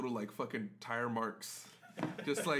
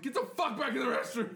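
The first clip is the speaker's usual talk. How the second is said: high, louder angry dad command; dad yelling; 12 semitones higher, 4 dB louder than usual. Retold or imitated. Dad yelling at his kid to get back in the restroom. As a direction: bark it like a fed-up dad. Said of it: "You don't have to yell".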